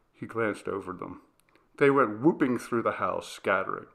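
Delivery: ordinary delivery, nothing marked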